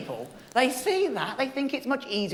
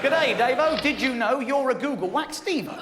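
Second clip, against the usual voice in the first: put-on Australian accent; 6 semitones higher than usual. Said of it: "I think he's Australian".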